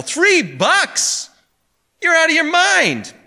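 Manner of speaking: very high, loud, wild disbelief; incredulous howl. A narrator's reading. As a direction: howl in outrage at the price. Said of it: "gave a wild, incredulous howl".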